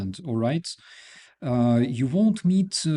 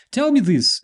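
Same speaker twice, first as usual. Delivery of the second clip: excited; 10 semitones higher, 7 dB louder than usual. Real, not acted. Host turns with a big question.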